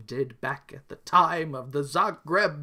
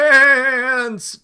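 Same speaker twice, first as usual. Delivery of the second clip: loud anguished scream of a name; 10 semitones higher, 13 dB louder than usual. Acted. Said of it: "Stefan screams".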